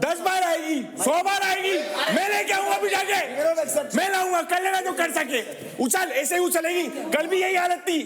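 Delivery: high, heated defiance; angry shouting. Real, not acted. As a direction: shout a challenge in a real row. Shouted threat in team quarrel.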